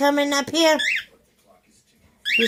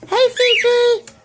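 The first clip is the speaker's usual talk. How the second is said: high pet talk; 10 semitones higher, 3 dB louder than usual. Real, not acted. Cooing to pet bird.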